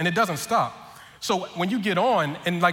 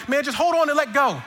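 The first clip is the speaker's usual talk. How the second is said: high, harried brush-off; flustered, rushed; 7 semitones higher, 4 dB louder than usual. Retold or imitated. Flustered attendant answers nervous rider, rushed and harried. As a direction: imitate a flustered worker in a raised voice. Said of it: "he's in a rush and flustered".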